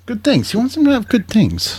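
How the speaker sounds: teasing high-pitched mimicry; mock high voice. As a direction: squeak it out to tease the message. Mocking the clip's message.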